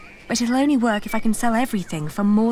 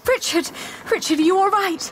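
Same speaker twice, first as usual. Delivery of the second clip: high, worried calling; anxious alarm; 8 semitones higher than usual. Acted. Sister to her hurt brother, anxious high voice. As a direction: urgently check that he is all right.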